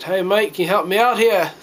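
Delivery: put-on playful Australian accent calling for help; mock Aussie plea. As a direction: ham up a cheeky Australian asking a favour. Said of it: "called out to the British and said".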